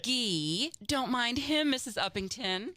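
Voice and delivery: lightly voiced fussing wife; smooth over her husband's rudeness; Molly. Molly soothing the guest, lightly fussing. Plays Molly in radio scene.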